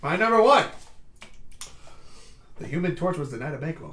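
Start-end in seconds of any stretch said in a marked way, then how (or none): 0.0-0.6 loud, high burst of excitement; excited shout. Real, not acted. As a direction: belt out your top pick. Excited shout of top pick.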